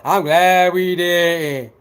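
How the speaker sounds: slow, drawn-out smug mimic; mock smug hater. Mocking the commenter, drawn out slowly. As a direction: sneer it slowly like a fake. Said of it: "Lying, talking about".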